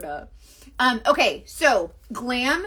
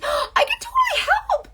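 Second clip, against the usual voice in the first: excited gasp; 4 dB louder than usual. Real, not acted. Gasps at viewer's request.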